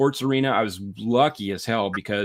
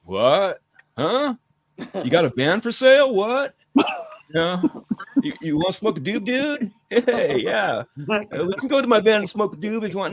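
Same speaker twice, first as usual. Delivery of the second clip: high, dopey stoner clerk voice; 8 semitones higher than usual. Retold or imitated. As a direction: play the confused stoned record clerk. Record store clerk voiced.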